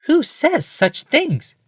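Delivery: a wife, high and amused; Rebecca's voice. A narrator's reading. Wife answering the overheard man, high woman's voice.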